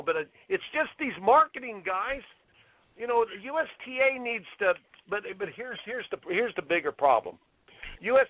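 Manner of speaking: usual speaking voice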